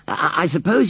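a hint of a bashful boy, halting; shy boy John. A narrator's reading. John voiced, stammering.

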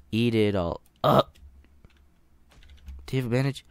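usual speaking voice

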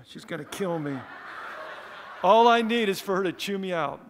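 rueful laughter breaking in; laughing. Real, not acted. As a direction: chuckle while dreading a scolding. Fearing the social worker, laughing.

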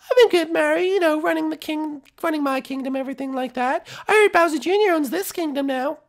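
high falsetto small talk; chatty princess voice. Acted. Princess making small talk on a date, high voice.

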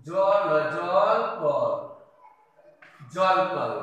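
জল drawn out very slowly, syllable by syllable; slow spelling demo. Shown as a demonstration. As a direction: stretch জল out slowly for the class. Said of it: "এইভাবে পড়বো".

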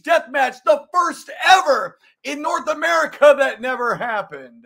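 high, loud announcer shouting; hype shout. Acted. Host announcing Sabu death match clip, shouted hype. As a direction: yell it like a wrestling promo.

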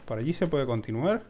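usual speaking voice

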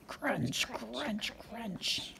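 hushed crunch, crunch; soft crunching. A narrator's reading. Parent voicing dragons eating, hushed. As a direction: breathe each crunch softly. Quiet crunch sound effect.

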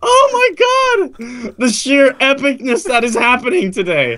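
high squeal easing into giddy awe; ecstatic amazement. Real, not acted. Winning player marvelling at his streak, ecstatic amazement. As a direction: squeal first, then gush in a high voice.

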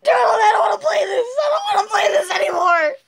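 high, exasperated whining; fed-up whine. Real, not acted. Player begs to quit the game, high fed-up whine. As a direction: whine it in protest.

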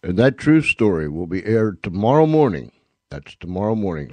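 usual speaking voice

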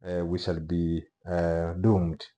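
ordinary delivery

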